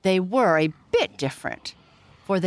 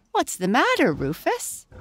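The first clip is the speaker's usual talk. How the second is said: higher, lightly voiced farm wife; farmer's wife asking; 7 semitones higher than usual. Wife gathering eggs asks her laughing husband, lightly voiced. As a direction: ask your husband what's going on. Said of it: "asked the farmer's wife".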